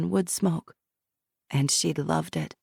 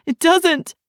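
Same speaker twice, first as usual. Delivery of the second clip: higher, louder, breaking with fear; desperate repeat; 12 semitones higher, 7 dB louder than usual. Acted. Shiloh as a child insists the fear stays, desperate high cry.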